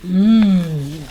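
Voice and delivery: low loud troll grunt. A narrator's reading. Troll grunting at the goat, a loud low grunt. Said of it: "grunted the troll".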